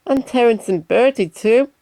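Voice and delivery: hopeful high question; Percy asking the Fat Controller to include friends, high and eager. Acted. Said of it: "asked Percy".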